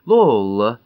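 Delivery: a loud, shocked cry of her name; shocked gasp. Acted. Hermy gasps her daughter's name, loud and shocked. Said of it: "ахнула Герми".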